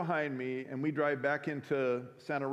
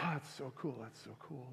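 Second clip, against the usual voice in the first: inner thought; 10 dB quieter than usual. Retold or imitated. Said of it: "I thought".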